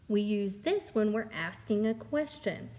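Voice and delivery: ordinary delivery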